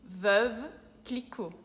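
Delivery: Veuve Clicquot slowly, rounded "eu" and silent T; French pronunciation, slowed. Shown as a demonstration. Teacher repeats the brand for learners, slow French Veuve Clicquot. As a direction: draw out Veuve Clicquot word by word. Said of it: "se chama Veuve".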